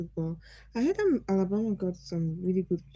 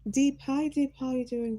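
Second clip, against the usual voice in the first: higher, louder happy hello; excited greeting; 6 semitones higher, 3 dB louder than usual. Real, not acted. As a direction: brighten up to greet him. Greeting new viewer.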